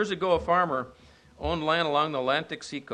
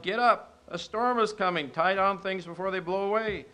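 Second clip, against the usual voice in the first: retold yell; 6 semitones higher, 3 dB louder than usual. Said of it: "shook the little man and yelled".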